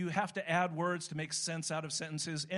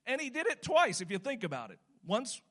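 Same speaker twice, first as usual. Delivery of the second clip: usual speaking voice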